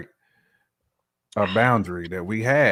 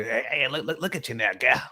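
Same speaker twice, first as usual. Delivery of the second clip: lightly voiced drunk uncle, a bit higher; a hint of a tipsy uncle ogling; 4 semitones higher than usual. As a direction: call out like a tipsy uncle at a family party. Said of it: "somebody's uncle gonna be".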